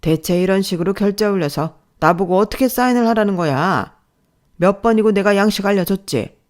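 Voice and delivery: loud, exasperated boss; angry scolding. Acted. Boss to employee over paperwork, angry scolding. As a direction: demand answers in exasperation. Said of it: "혼이 나고 있었어요".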